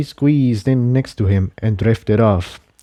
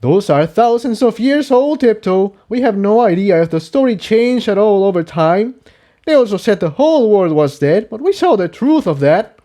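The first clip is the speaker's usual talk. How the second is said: higher, cutting in; higher colt voice, sharply dismissive; Jigsaw, sharp; 10 semitones higher than usual. Acted. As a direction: shut her idea down. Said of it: "Jigsaw interrupted".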